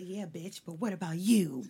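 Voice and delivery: low, soft devilish aside; taunting Satan. Retold or imitated. Satan interrupting her happy moment, low taunt. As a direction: needle her quietly as Satan. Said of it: "He said".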